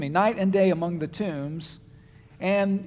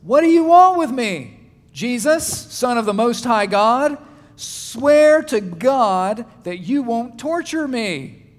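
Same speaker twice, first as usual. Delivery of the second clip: higher and louder, crying out to Jesus; shouted plea; 4 semitones higher, 7 dB louder, slower than usual. Acted. Possessed man at the tombs shouting at Jesus, loud and pleading. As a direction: shout it, then slow right down to beg. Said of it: "he shouted at the top of his voice".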